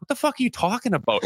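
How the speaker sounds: high-pitched, baffled retort; retold incredulity. Player replays his reply to the trainer, incredulous. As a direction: throw it back in mock disbelief.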